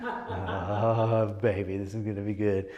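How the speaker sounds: lightly voiced delighted reverend; a hint of a thrilled preacher; mild mock delight; gleeful preacher voice. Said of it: "it's Doctor Seuss".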